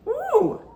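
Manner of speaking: high, drawn-out teasing "ooh"; playful high whoop. Real, not acted. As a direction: tease the tough-guy talk. Reaction to threat to punch.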